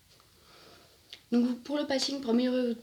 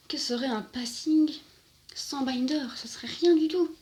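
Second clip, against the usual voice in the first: high, emphatic, climbing to a louder finish; hammed-up rhetorical flourish, pitch raised; mock-dramatic; 5 semitones higher, 4 dB louder than usual. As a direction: overplay it like a grand declaration. Mock-dramatic rhetorical question and answer.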